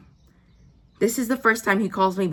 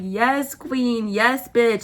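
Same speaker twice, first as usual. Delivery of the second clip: sassy read; 4 semitones higher, 3 dB louder, much slower than usual. Retold or imitated. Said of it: "I read it as".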